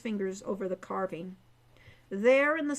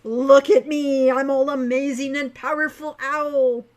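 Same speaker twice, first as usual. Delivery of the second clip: mocking mystic; 3 semitones higher, 11 dB louder than usual. Hawk taunting Owl, loud hammed-up mock wizard. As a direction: ham it up as a mock powerful sorcerer. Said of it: "made exaggerated gestures of mystery".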